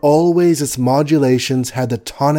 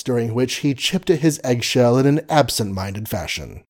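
plain reading voice

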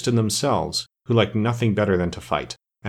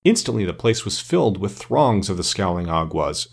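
usual speaking voice

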